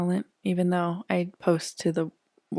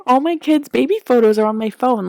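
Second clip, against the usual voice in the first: higher, louder retold wail; mock-distraught cry, voice raised; 5 semitones higher, 6 dB louder than usual. Mother retelling losing her baby photos, mildly wailing. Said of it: "I cried so bad".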